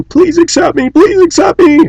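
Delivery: very high squeaky begging little dog; high whimpering plea "Please accept me"; pleading chihuahua. Pretend. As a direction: beg like a tiny yapping dog. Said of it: "German shepherd going".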